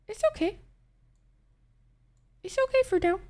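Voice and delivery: tiny high voice. Acted. Small high voice, congested.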